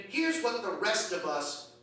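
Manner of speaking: ordinary delivery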